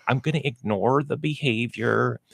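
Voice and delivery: soft feigned indifference; mock ignoring. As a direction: imitate an owner quietly looking the other way. Voicing owners who pretend nothing happens.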